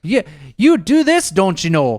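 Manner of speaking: high, loud Wisconsin buddy imitation; Wisconsin accent. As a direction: ham up the Upper Midwest accent. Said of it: "you'll hear somebody talk from Wisconsin".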